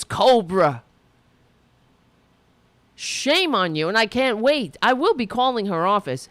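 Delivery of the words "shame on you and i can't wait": ordinary talk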